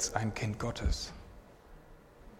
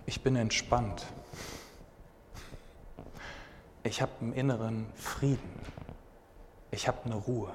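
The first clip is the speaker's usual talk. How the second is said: low, soft and unhurried; calm and peaceful; 3 semitones lower, 8 dB quieter than usual. Real, not acted. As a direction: speak it as if the peace is right there. Describing inner peace, calm. (ruhig und leise, voller innerem Frieden)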